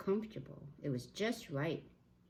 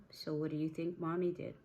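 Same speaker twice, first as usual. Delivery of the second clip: ordinary delivery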